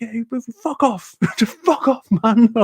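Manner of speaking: high-pitched dismissal breaking into laughter; laughing mock outrage. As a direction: re-enact sending someone away, laughing through it.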